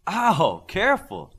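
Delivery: sharp pained ow, then a high careful; pained yelp. Acted. Midas yelping when Glimmer shakes his hand too hard. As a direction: yelp as the grip crushes your hand.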